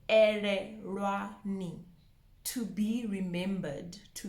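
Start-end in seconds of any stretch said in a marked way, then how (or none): 0.1-1.8 "Ererwani" slowed, syllables set apart; slow breakdown. Shown as a demonstration. Teacher insisting there's no R, slow careful syllables. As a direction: draw out "Ererwani" with L-like sounds, no R. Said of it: "break it down and say it slowly".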